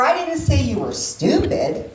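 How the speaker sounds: "stupid" punched, drawn out at the end; stress on STUPID. Shown as a demonstration. Speaker means ugly but not that, stress on "stupid". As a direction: save the weight for "stupid". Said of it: "emphasis on each one of them individually".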